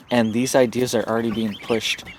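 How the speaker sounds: ordinary talk, nothing marked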